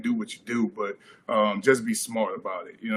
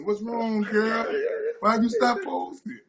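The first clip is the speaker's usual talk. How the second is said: mock concerned girlfriend; 9 semitones higher, slower than usual. Mock sympathy to friend.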